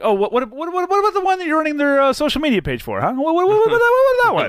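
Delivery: high, loud, rapid teasing what-what-what; mock outrage. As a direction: pepper him with high fast whats. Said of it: "I'm like".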